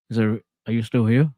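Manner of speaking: choppy, distant audio dropping out; bad connection. Real, not acted. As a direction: voice breaking up over a bad line. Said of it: "My internet went out".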